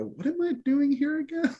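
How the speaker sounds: high-pitched mock bewilderment; self-questioning. Worker, spouse or parent asking themselves, mock bewilderment. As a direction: pitch it way up, imitating someone doubting themselves. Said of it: "you kind of go".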